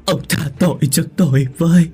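high, loud wailing lament; sobbing wail. A narrator's reading. Widow begging her dead husband's forgiveness, sobbing wail. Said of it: "nức nở gào lên".

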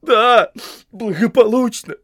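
high, loud sobbed retort; hurt, whimpering protest; sobbing. A narrator's reading. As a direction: throw it back through tears. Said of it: "всхлипнул Сэм".

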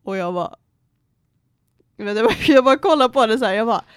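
normal talking voice

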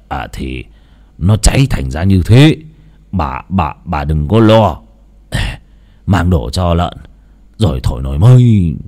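hide your own fear while soothing her; reassuring. Acted. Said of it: "cất giọng trấn an".

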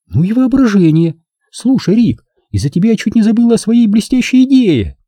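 high, excited woman's voice; Nina, an admiring woman. A narrator's reading. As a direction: gush in a high feminine voice. (восторженный высокий женский голос)

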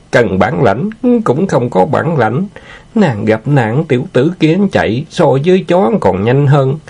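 high-pitched, scornful wheedling; lecherous villain, coaxing and boastful. Acted.

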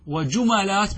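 وجملات as "wa-ju-ma-laat", a after the m; middle vowel a. Shown as a demonstration. Teacher lists plural forms to students, وجملات as "wa-ju-ma-laat". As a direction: say وجملات with a fatha on the mim. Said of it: "بالفتح".

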